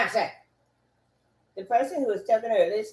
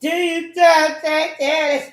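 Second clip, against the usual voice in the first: high, drawn-out loud cry; shouted outburst; 6 semitones higher, 6 dB louder than usual. Real, not acted. Speaker repeating a question mid-rant, shouting. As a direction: push it up high and draw it out.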